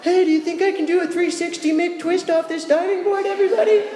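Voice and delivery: very high, excited show-off call; a kid's high, thrilled shout to the crowd; eager kid. Retold or imitated. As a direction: announce the stunt to everyone like a proud kid. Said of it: "I'd be like".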